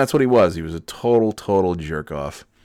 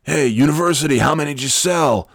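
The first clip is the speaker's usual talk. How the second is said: high, raised-voice call; intense coworker impression; 8 semitones higher, 3 dB louder than usual. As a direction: burst in as the intense salesman. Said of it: "come in and be like".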